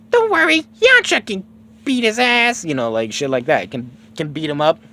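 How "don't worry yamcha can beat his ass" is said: high-pitched little squeaky-character voice; Por. Retold or imitated. Por reassuring the villagers about Yamcha, high-voiced. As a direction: put on a very high, loud voice. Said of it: "Poor says".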